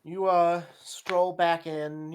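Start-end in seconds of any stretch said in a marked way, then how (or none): none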